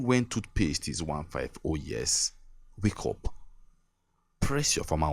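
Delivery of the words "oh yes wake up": usual speaking voice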